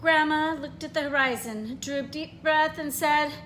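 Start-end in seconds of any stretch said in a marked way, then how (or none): none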